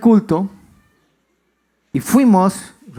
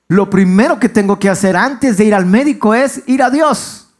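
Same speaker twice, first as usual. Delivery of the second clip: louder, higher pulpit delivery; emphatic sermon voice; preaching emphasis; 6 semitones higher, 5 dB louder than usual. Acted. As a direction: drive the point home to the congregation. Pastor preaching at full force.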